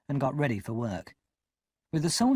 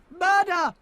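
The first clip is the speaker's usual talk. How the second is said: high, loud cry of murder; cried out; 6 dB louder than usual. Acted. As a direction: scream it across the marsh. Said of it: "one cried".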